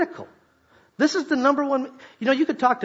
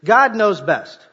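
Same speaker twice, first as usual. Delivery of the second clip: "God knows best" called out louder, falling hard on "God"; 4 semitones higher, 8 dB louder, much slower than usual. Shown as a demonstration. Pastor leads the teens in a louder chant. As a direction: lead the crowd, full voice. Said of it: "Say with me this".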